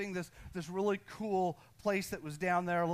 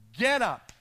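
a firm, louder command; 4 semitones higher, 9 dB louder than usual. Acted. God orders Gideon to rise, firm and louder. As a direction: bark it out like an order from above.

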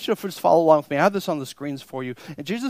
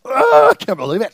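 mock exasperation; 6 semitones higher, 10 dB louder, faster than usual. Mimics angry congregant.

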